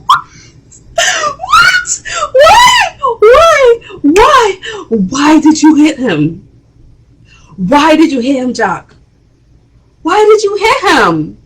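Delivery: very high, loud, cracking cries of why; outraged disbelief. Real, not acted. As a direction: demand an answer in shock. Reacting to Jock hitting son.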